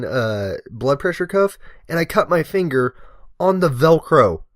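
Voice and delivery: usual speaking voice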